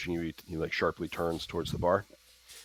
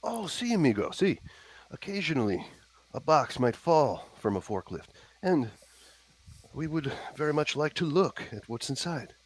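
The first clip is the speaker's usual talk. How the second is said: hammy Spanish-flavored voice; 8 semitones higher than usual. Acted. Player's character talking in fake Spanish.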